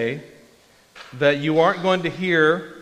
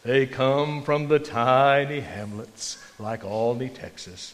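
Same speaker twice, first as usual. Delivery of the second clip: put-on slow, louder, booming old-time preacher; slow, louder old Baptist preacher drawl; W A Criswell impression; 5 dB louder, slower than usual. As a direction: savour each word like a grand Southern pulpit voice. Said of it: "he would just talk like that".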